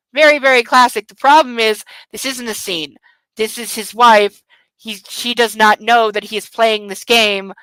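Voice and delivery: usual speaking voice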